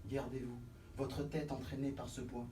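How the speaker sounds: cautionary. Acted. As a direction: warn them quietly. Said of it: "Prévenons".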